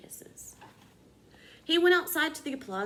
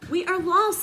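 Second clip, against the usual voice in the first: high, loud wail of despair; despairing crowd; 8 semitones higher, 9 dB louder than usual. A narrator's reading. Townspeople giving up before the pasta, despairing. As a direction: wail it hopelessly. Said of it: "said the people".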